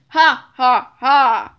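loud, low gloating laugh; evil villain laugh. A narrator's reading. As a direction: cackle in triumph. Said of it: "he shouted".

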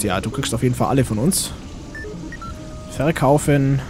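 usual speaking voice

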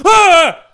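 sudden high gasping scream; startled scream. Real, not acted. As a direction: yelp at the jump scare. Jumpscare in horror game.

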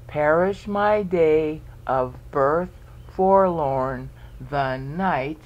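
slow verse in separate beats; counting out syllables. Shown as a demonstration. Teacher counts the verse over, slow syllable by syllable. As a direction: count along as you read, slowly. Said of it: "count it all again".

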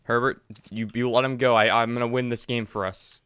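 ordinary talk, nothing marked

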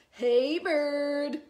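loud, high, drawn-out holler; raccoon calling out. Acted. Raccoon hailing Bird as he passes, long loud call. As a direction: stretch the greeting right out.

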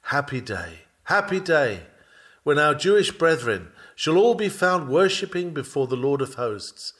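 ordinary talk, nothing marked

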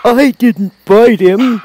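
loud, high, drawn-out lion voice; Cowardly Lion, protesting. A narrator's reading. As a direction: protest loudly and slowly as a hurt lion. Lion defends himself.

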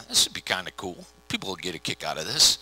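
low, soft private musing; quiet, low inner voice; thinking to himself. Retold or imitated. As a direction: drop down as if voicing a private thought. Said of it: "he thought to himself".

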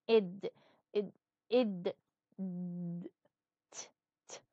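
id, id, id, then d, then t whispered without voice; isolated ending sounds. Shown as a demonstration. Teacher isolating ending sounds for students, fading to a breath. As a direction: say the endings alone, t only as breath. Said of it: "عم نحكي على الصوت".

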